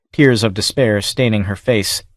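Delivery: ordinary delivery, nothing marked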